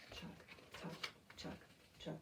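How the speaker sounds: soft whispered chuck beat; quiet train chant; whispered train rhythm. Shown as a demonstration. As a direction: whisper the chugs steadily. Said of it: "Keep that beat going".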